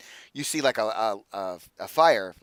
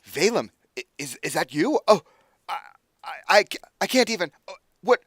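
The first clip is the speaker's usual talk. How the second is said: stammering, rising astonishment; stunned disbelief; 6 semitones higher, 5 dB louder than usual. Acted. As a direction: get higher and louder, too overwhelmed to finish. Old friend recognizes visitor, overwhelmed.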